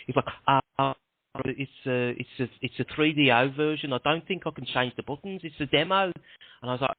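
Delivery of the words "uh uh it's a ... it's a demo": put-on hesitant producer with a Brummy accent. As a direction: stall apologetically in imitated Brummy. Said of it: "so I'll do a Brummy accent".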